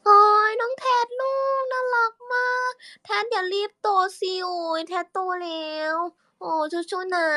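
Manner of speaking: put-on very high sing-song baby talk; voice for little Tad. Shown as a demonstration. Cooing to little brother Tad in a very high sing-song voice. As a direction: coo up high like talking to a toddler. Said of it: "คุยกับน้องแทดเหรอ".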